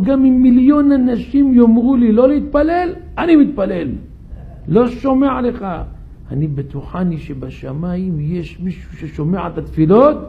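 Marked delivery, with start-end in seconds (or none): 0.0-5.7 loud, higher, defiant retort; furious stubborn refusal; angry defiance. Acted. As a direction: refuse him hotly, voice raised. David angrily refuses Jacob's advice.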